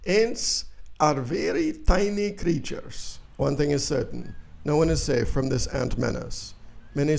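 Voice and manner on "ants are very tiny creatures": slow, higher pompous expert voice with an odd timbre; Doctor Hans Heinz. Acted. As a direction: play a self-important Viennese professor lecturing slowly. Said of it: "contacted Doctor Hans Heinz".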